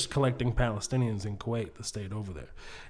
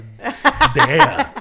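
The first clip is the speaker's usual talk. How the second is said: laughing exclamation; 13 semitones higher, 12 dB louder, slower than usual. Retold or imitated. Mock-fleeing joke, laughing.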